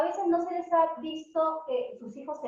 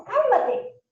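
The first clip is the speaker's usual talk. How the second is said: put-on parent hushing child; 10 semitones higher, 5 dB louder than usual. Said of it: "¿nosotros qué hacemos?".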